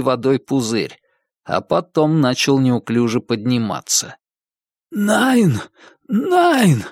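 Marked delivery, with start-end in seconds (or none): 4.9-6.8 high-pitched, hoarse protest from a hanged man; raised, hoarse plea in German; hoarse mutter. Acted. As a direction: croak it out high and strained. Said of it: "хрипло пробормотал он".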